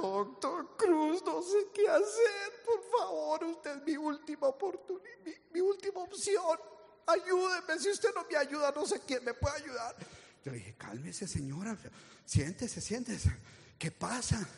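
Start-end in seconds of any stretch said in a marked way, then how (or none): 0.0-10.0 crying plea. Retold or imitated. Distraught woman begging the doctor, tearful plea. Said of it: "llegó llorando". 10.8-14.5 soft, lower, soothing; calming. Retold or imitated. Doctor calming the crying woman, soft and soothing.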